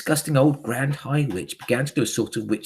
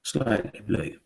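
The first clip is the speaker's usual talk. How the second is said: usual speaking voice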